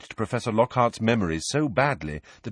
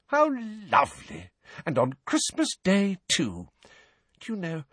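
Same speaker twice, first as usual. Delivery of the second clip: motherly healer; 10 semitones higher than usual. A narrator's reading. Healer delighted her patient has visitors, high motherly cooing. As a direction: coo as to a precious toddler.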